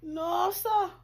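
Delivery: high, loud crying protest; whiny cry. Acted. Teen crying back at scolding mother. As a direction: wail the words out.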